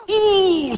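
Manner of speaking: long, very high sympathetic "oooh"; wincing "ooh". Acted. Game voice watching a painful fall, high wince. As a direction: wince in a high, held note. Reacts to player's crash.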